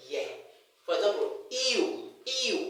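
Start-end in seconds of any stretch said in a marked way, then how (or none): 1.5-2.7 EW begun with a little ee sound; ee-oo run together as ew; glide pronunciation. Shown as a demonstration. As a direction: slip a little ee in before the oo of ew. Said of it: "there's a little e sound".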